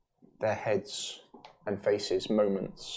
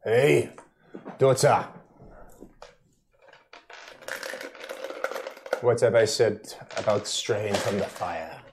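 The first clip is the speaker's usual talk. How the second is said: put-on loud, gruff Russian accent; a blunt Russian father calling out; 4 dB louder than usual. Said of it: "Aragorn was a bit of a dickhead Russia".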